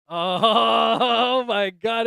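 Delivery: long, loud, high-pitched gasp of words; shocked discovery. Real, not acted. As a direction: stretch it out in loud disbelief. Discovers the stranger is a guy.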